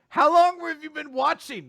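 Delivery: loud, high-pitched startled demand; mock shocked outcry. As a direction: cry out as if caught, hammed up. Couple catch dad peeking.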